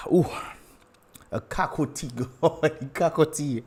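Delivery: normal talking voice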